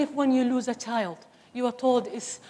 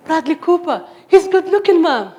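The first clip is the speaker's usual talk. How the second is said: imitating daughter; 6 semitones higher, 10 dB louder than usual. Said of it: "And she'll say".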